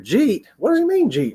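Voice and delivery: "Jeet?" squeaked way up high in confusion; baffled repeat. Retold or imitated. Echoing a neighbor's odd "Jeet?" with high puzzled disbelief. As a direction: play dumb, pitch shooting up as if the word makes no sense. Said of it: "And you go".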